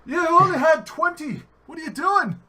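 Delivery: high-pitched, loud mock-Irish voice; Irish accent. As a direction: goad a drinking buddy in a hammed-up Irish accent.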